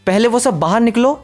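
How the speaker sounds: high, sharp angry yell; angry shout. A narrator's reading. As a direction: order them out in fury. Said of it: "गुस्से से चिल्लाती है". (गुस्से में ऊँची आवाज़ से चिल्लाना)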